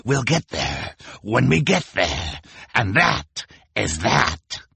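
slow, emphatic villain's snarl; Count Olaf snarling. A narrator's reading. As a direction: shut them down, slow and snarling. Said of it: "replied Olaf with a snarl".

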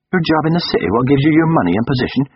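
high-pitched, scandalised disbelief; incredulous protest. A narrator's reading. Lover hearing the job will be chucked, incredulous. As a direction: sound aghast at the very idea.

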